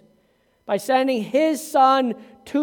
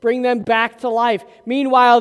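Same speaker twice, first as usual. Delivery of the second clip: ordinary talk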